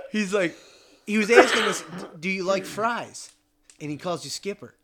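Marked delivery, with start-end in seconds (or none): 2.2-3.3 a hint of the slurred Norwegian cook, slow; lightly voiced drunk cook; light drunk accent. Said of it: "Norwegian accent".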